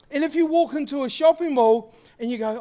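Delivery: normal talking voice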